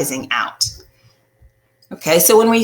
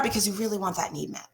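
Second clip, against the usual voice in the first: ordinary talk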